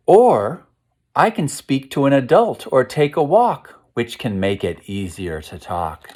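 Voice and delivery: lilting storytime rhyme, opening with a lift; reading to children. A narrator's reading. Teacher reading the walking page to class, sing-song read-aloud. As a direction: read the couplet like a picture book page. Reads rhyming picture book aloud.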